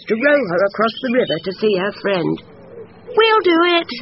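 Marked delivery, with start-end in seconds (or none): 3.0-3.9 high, keen shout of brothers; eager cry. A narrator's reading. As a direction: jump at the chance, calling it out. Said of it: "cried Peter and Paul".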